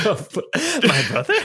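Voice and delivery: high, slow surprised question. Real, not acted. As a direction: blurt it in disbelief. GM surprised, laughing.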